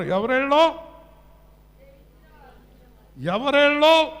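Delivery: high, loud pulpit proclamation; full-force preaching. Real, not acted. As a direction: ring it out to the congregation. (గట్టిగా, హెచ్చు స్వరంతో ప్రసంగం)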